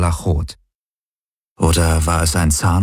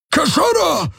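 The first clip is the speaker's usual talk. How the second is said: roared high and far off his usual voice; roaring. A narrator's reading. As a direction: bellow it like a beast. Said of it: "brüllte er sie an".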